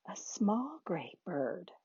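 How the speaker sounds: put-on quiet little girl's whisper. A narrator's reading. Quiet girl nobody can hear, whispering. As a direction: whisper it softly, barely audible. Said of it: "Nobody could hear her".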